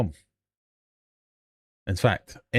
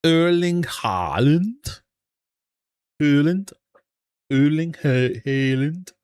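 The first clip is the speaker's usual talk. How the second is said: louder, higher Norwegian accent attempt on Haaland; Norwegian accent try; 5 semitones higher, 4 dB louder, slower than usual. Shown as a demonstration. Host attempting the footballer's name in a Norwegian accent. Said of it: "I'll try and do the accent".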